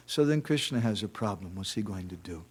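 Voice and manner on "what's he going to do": ordinary delivery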